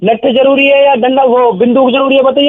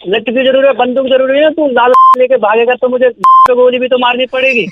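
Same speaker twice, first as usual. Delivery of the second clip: ordinary delivery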